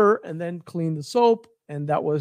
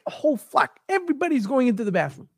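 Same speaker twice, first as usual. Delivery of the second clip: high, hurried, dismayed aside; retold panic, pitched high and kept soft; mock alarm; 6 semitones higher, 4 dB quieter, faster than usual. Acts out shock at crowd.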